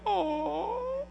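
sympathetic oh. Retold or imitated. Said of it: "some of you mamas are like".

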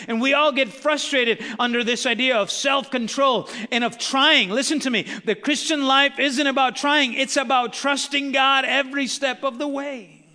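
loud, high, rising preacher's cry; full-force preaching. Acted. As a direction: preach it hard, building to a shout.

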